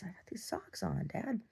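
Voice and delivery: hushed whisper, barely voiced; whispered to dad. Telling dad about socks, whispered.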